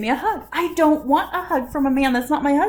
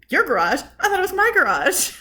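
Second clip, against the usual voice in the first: loud, incredulous comeback, pitch jumping up; indignant; 7 semitones higher, 5 dB louder than usual. Retold or imitated. Wife retorting to husband over the garage, indignant. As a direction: challenge it with mock outrage.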